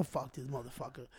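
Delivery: low, very soft swearing aside; retold muttered curse. Retold private reaction on walking in.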